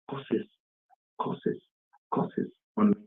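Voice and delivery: very soft, trailing repetition; subdued. Real, not acted. Man recounting the curses rained on him, subdued. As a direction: let each word sink away quietly, with pauses. Dwelling on abuse received, emotional.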